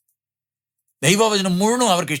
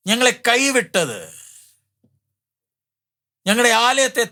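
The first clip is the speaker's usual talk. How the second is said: raised-pitch mock lament of the captives; captives' lament; 7 semitones higher than usual. Preacher voices captives asking who abandoned them, high-pitched lament. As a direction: voice a people's complaint, pitched high, with long pauses. Voicing the captives' despairing complaint.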